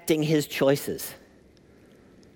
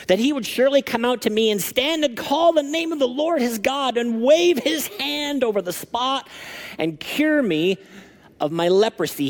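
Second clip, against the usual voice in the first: high, raised voice of a proud, offended general; indignant Naaman; 8 semitones higher than usual. Acted. Pastor voicing Naaman to the congregation, indignant and high. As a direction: read it like a man sure he deserved a show.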